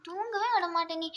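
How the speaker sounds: ordinary talk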